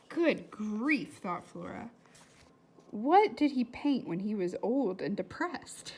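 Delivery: put-on lively read-aloud to children; story reading. A narrator's reading. Teacher reading a novel aloud to her class, lively. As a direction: bring the chapter to life for the class.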